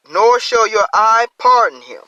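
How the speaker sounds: loud, lifted reading of the verse; scripture reading. Acted. Preacher reading scripture aloud to the congregation, loud and lifted.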